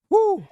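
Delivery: very high, loud celebratory whoop; excited whoop. Real, not acted. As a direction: let out a joyful cheer. Cheering a big play.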